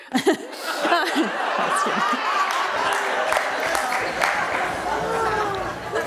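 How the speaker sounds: long real laughing fit; high, drawn-out laugh. Real, not acted. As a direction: break up laughing at your own story. Laughing at her own story.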